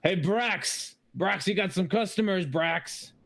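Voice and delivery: dwarf calling out to Brax. Acted. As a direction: call Brax from the back table. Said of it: "one of them yells out".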